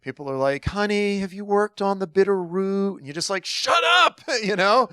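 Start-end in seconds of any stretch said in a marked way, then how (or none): 0.6-3.0 higher, sugary mock concern; condescending well-wisher. Imitating people advising the chronically sick, mock-sweet. 3.4-4.2 very high-pitched mock outburst; exasperated retort. Sufferer answering unwanted advice, mock-exasperated.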